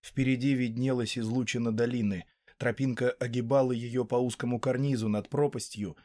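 usual speaking voice

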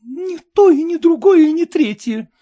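high, loud, tearful irritation; irritated through tears. A narrator's reading. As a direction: snap back at the husband through tears. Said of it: "раздражённо сквозь слёзы проговорила жена".

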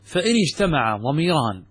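ordinary delivery

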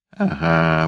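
ordinary delivery

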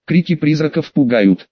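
ordinary delivery, nothing marked

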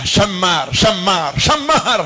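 Syamar with sh, repeated; Arabic pronunciation. Shown as a demonstration. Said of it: "Ya, aslinya syamar".

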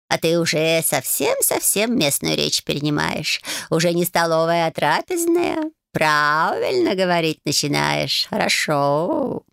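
slow purring cat voice. Acted. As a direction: drawl it like a smug old cat. Cat praises her.

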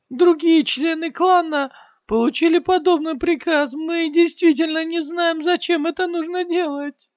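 very high, loud, terrified cry; fearful shout. Acted. Maimed warrior screams in terror at Shiyan. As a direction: beg for your life in panic.

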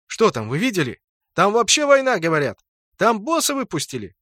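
high, agitated crowd gossip; excited chatter. A narrator's reading. Players at the gate blurt out rumours, excited chatter. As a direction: blurt out news, voice jumping up. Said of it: "Игроки затараторили".

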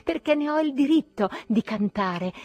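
defiant, building. A narrator's reading. Narrator claiming his right to sing, increasingly defiant. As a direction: build your claim towards a shout.

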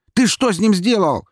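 high furious yelling; angry yell. Acted. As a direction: scream the question. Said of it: "закричал он".

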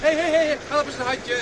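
loud, high urgent calls; calling out. Acted. Paul calls to the rowers for help, loud calls. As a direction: shout for a hand.

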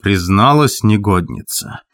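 ordinary delivery

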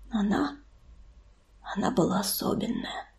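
very soft, halting murmur; barely audible tenderness; hushed grief. A narrator's reading. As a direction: falter softly, pausing long. Grieving son speaks of dead companion.

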